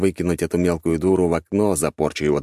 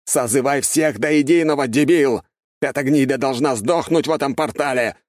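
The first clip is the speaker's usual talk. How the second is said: irritated shouting; 8 semitones higher than usual. A narrator's reading. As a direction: yell at the idiot in fury. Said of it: "раздражённо закричал куратор".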